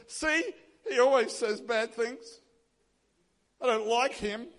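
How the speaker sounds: slow, mock-petulant grumble of a king; petulant complaint. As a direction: act out a drawn-out childish told-you-so. Sulky king complains about prophet.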